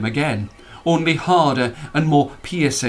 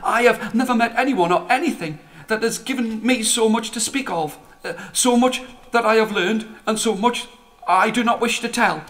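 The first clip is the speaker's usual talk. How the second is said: put-on much higher, halting young voice; a small timid comet searching for words; hesitant comet; 7 semitones higher than usual. Comet nervously answers the old man, higher and hesitant. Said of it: "that the comet spoke".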